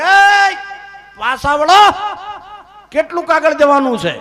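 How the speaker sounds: loud high shout calling ahead; tired shout from far behind. Retold or imitated. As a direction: yell after the girls to turn back. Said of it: "એટલે બૂમ પાડી કે".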